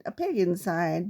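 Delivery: plain reading voice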